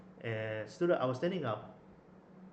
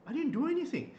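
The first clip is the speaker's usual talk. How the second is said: retold alarmed protest; 11 semitones higher, 7 dB louder than usual. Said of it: "I was like".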